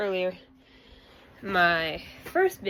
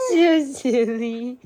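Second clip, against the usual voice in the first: high, slow, louder coo on "You're so silly"; 4 semitones higher, 9 dB louder, slower than usual. Real, not acted. Baby talk vs plain.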